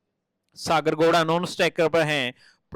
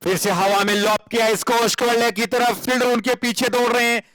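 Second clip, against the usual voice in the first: higher, excited boundary call; excited cricket commentary; 7 semitones higher, 3 dB louder than usual. Acted. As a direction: build to the four.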